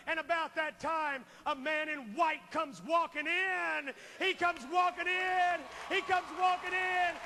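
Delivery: loud revival preacher, surging; preaching at full force. Acted. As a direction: drive the altar call home like a revival preacher.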